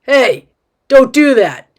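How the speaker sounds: dad's warning. Acted. Dad warns girl pushing the box, loud warning. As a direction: call out a sharp warning. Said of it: "he warned her".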